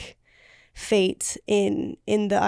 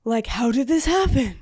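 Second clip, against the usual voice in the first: imitating his shocked disbelief, rising higher; mock shock; 5 semitones higher than usual. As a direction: play the stunned husband, a bit hammed-up. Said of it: "he was like".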